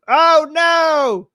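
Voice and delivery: loud, high mock alarm. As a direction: yelp in fake panic.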